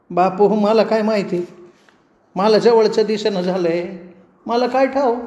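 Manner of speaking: put-on high, trembling old woman pleading with the policeman; a scared granny voice, pitched well up; frightened old woman. A narrator's reading. Old woman pleads with the constable, high and scared.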